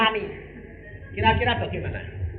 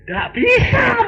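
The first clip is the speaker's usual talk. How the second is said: exclaiming; 6 dB louder, much slower than usual. Acted. Exclaims, shout heard.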